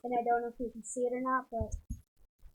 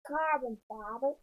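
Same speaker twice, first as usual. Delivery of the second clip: Farmer impression. Daughter answers how they pronounce it, Farmer impression. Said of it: "that's my impression of Farmer voice".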